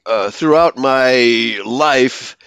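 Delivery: normal talking voice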